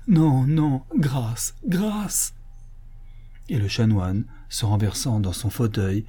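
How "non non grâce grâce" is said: anguished plea thinning to a high whisper; beg, the voice failing on the last word; pleading for mercy. Acted. Canon imploring his imagined seducer, desperate plea.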